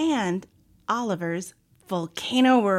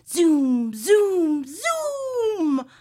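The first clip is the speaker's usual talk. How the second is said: drawn-out zooms climbing higher; playful "zoom" swooping up higher each time; rocket zooming noise; 7 semitones higher, 5 dB louder, much slower than usual. Acted. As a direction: zoom like a kid's rocket taking off. Playing astronaut flying a rocket.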